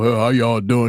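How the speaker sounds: low, smooth-talker imitation; Mims's cool-daddy voice.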